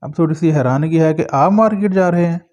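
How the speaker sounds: ordinary delivery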